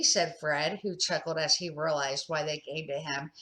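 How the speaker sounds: ordinary delivery, nothing marked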